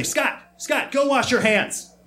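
high urgent mock call; frantic. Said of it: "and said frantically".

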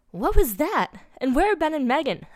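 high, loud alarmed cry; acted panicky scream, short of full force. A narrator's reading. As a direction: shout the questions in alarm. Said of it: "Al screamed".

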